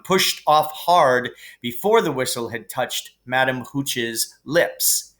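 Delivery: ordinary delivery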